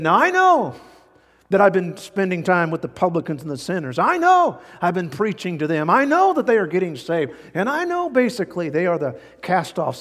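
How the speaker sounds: troll them with heavy sarcasm; sarcastic Jesus. Acted. Jesus mocks the false teachers, loud sarcastic jabs. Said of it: "he's gonna get a little sarcastic here".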